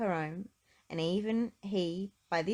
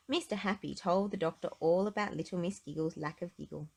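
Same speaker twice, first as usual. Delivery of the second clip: plain reading voice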